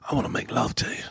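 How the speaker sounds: put-on British accent, romantic; sultry British. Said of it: "You see how that sounds?".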